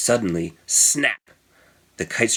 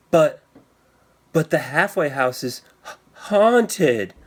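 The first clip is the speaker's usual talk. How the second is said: put-on nervous boy; 5 semitones higher than usual. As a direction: stumble over it like a spooked little boy. Tommy nervous about haunted house.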